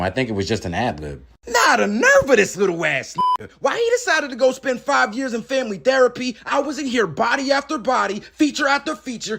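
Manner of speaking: ordinary talk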